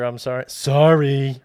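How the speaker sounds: put-on a loud, dismissive teen "Sorry."; mock teenager sorry. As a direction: toss off the sorry like a sulky teenager. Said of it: "like the teenager".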